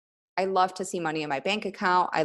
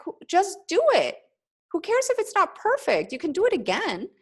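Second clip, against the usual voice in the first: much higher, bright pep-talk push with a laugh; excited urging; 12 semitones higher than usual. Retold or imitated. Coach to training group, urging them to just start, excitedly. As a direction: cheer them on, no excuses. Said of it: "And I was like".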